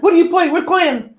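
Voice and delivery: high, loud gatekeeping nerd voice; squeaky indignant fan quizzing; mock Max. Mocking Max quizzing someone about Battletech, high and loud. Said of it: "Max would have a cow".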